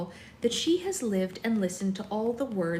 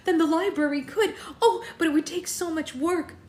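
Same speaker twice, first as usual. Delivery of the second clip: much higher, laughing with delight; thrilled; 9 semitones higher, 4 dB louder than usual. Acted. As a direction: gush with joy. Bella clasps her hands.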